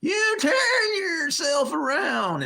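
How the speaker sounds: high, loud shout that cracks; voice cracking scream. Retold or imitated. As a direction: scream till the voice breaks like a tiny drill instructor. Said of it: "his voice would crack".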